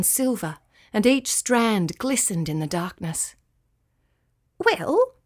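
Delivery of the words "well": high-pitched, hesitant little cricket; little creature clearing the way to confess; small cricket, hesitant. A narrator's reading. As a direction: pipe up timidly in a small high voice. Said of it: "coughed Charlie".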